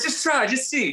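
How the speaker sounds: high, bright coaxing wife voice; mock high-pitched encouraging wife; wife's coaxing. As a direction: nudge him like Mallory would. Retelling wife urging him on.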